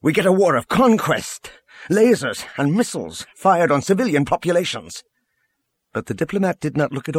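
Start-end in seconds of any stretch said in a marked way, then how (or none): 0.0-5.0 higher, emphatic indictment; angry, punching the key words; anger. A narrator's reading. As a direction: press the accusation with controlled anger. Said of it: "He could hear the anger in his own voice".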